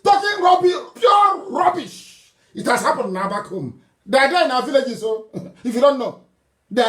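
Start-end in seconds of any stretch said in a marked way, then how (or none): 0.0-2.3 high, loud, dragged-out contempt; furious shout. Real, not acted. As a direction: blast it with scorn, stretching pure rubbish. Ranting against critics abroad.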